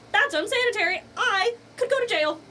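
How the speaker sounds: high, loud prim waiter voice; scandalized restaurant staff; snooty waiter. Retold or imitated. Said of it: "They're gonna be like".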